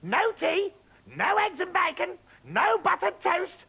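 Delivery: high, loud indignant tirade; shouting. Acted. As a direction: demand breakfast at the top of her voice. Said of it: "and she shouted".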